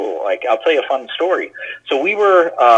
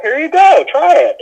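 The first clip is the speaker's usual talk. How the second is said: friendly professor; 6 dB louder than usual. Retold or imitated. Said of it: "He's like".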